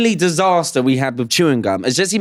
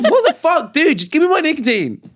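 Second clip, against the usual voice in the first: outraged disbelief; 13 semitones higher than usual. Retold or imitated. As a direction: squeak it out, half-laughing, stunned. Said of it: "And I was like".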